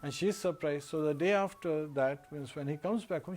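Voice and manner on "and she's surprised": usual speaking voice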